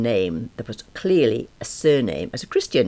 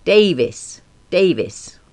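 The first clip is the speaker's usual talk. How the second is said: terse caller; 6 semitones higher, 4 dB louder, much slower than usual. A narrator's reading. Caller insists on name.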